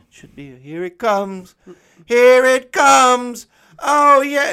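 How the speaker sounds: from eager to high, loud, slow cry; building excitement. Retold or imitated. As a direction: climb from anticipation to a joyful yell. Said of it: "he was like".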